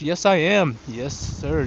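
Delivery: ordinary talk, nothing marked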